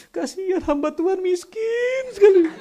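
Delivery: high, loud comic wail; mock crying. Imagined principal weeping over a poor pastor, hammed-up crying. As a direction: bawl it out in exaggerated pity, breaking into laughter. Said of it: "dia nangisnya dengan berkata seperti ini".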